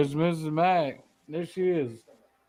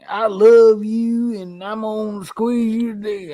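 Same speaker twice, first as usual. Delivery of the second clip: a sappy cooing hugger, lightly put on; mock huggy sentimental voice; 4 semitones higher, 4 dB louder than usual. Guy mocking how huggy people talk, slightly higher. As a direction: spoof the gushy affectionate type. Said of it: "I'm just not that type of person".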